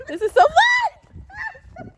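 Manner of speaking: high, slow gleeful cry; thrilled and breathless in the snow; delighted squeal. Real, not acted. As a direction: let the joy stretch out high.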